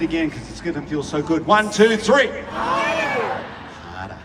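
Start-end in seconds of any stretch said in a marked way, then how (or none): 2.5-4.2 Harder sung way up high and drawn out, no R; mock sexy harder. Shown as a demonstration. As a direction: croon hadder high and long. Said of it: "say it after me".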